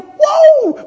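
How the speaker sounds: high laughing whoa; a high, laughing cry of wonder; excited whoa. Acted. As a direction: whoop with delight. Excited cry about the return.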